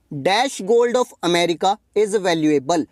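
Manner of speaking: very slow, word by word; slow read-out. Shown as a demonstration. Teacher reading exercise sentence to class, very slow. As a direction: read the question out slowly for students to follow.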